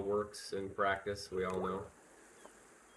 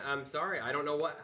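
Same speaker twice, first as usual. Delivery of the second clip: ordinary delivery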